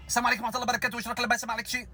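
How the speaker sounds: fast, high, irritated Algerian gabble; mock Algerian rant. Shown as a demonstration. Mocking Algerian speech to a friend, fast and irritated. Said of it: "تتكلم بسرعة قوي قوي ... وأنت متعصب".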